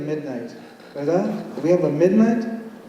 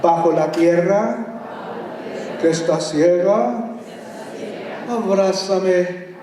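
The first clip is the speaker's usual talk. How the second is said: slow, rhythmic Spanish poem reading; dramatic Spanish verse reading; poem recital; slower than usual. Acted. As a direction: chant the verses like an incantation. Reads a Spanish poem aloud.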